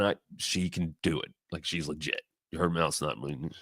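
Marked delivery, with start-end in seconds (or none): none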